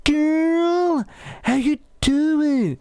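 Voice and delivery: high, drawn-out jokey hello; unserious greeting. Acted. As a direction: stretch a playful high greeting. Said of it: "instead of talking to them seriously, I go".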